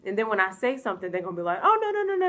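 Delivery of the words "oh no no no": high, loud mocking no no no; mimicking the family brushing off her French, high and loud; mock dismissive host family. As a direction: ham up their brushing-off reply.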